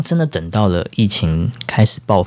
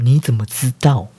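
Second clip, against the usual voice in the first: frightened; 5 semitones higher than usual. Retold or imitated. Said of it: "很惊恐的看着我说".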